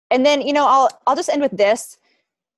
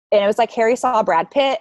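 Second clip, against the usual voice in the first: usual speaking voice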